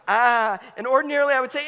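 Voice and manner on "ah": loud helpless "ah" of a new dad; a hammed-up yelp of alarm; mock panic cry. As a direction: cry out like you're overwhelmed. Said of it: "And I'm like".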